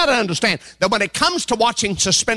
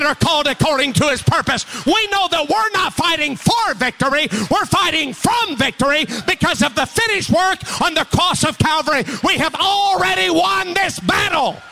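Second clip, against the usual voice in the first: much higher, shouted victory preaching; preaching at full force; 6 semitones higher than usual. Acted. Pastor rallying his church about victory, full-force shouted preaching. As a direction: build the congregation to praise.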